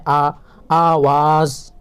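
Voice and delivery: آ drawn out long, then آواز slowly; stretched vowel. Shown as a demonstration. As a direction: hold the آ long before آواز. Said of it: "اس کو کھینچ کر پڑھنا ہے". (آ کو کھینچ کر لمبا پڑھیں)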